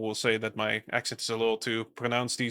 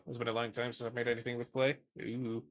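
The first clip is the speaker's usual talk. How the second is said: normal talking voice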